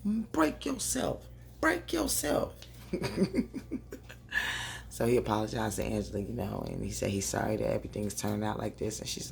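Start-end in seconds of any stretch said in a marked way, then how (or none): 0.1-2.5 high, slow, dismissive attitude; sassy brush-off. Retold or imitated. Vlogger voices Nikki snubbing her father, high sassy scorn. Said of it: "She walked right past him like". 2.6-4.8 high laugh. Real, not acted. Laughing at own imitation.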